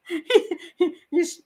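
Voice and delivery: breaking into real laughter; can't get the words out for laughing. Real, not acted. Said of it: "I'll try not to laugh".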